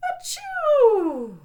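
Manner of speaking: gasping build-up, then a loud sneeze; hammed-up bear sneeze; big sneeze. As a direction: act out a huge bear sneeze.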